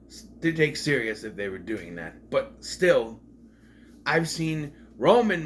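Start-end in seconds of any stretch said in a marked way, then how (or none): none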